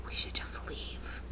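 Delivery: slow, whispered escape plan. Retold or imitated. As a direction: whisper it so he can't hear. Whispering to friend.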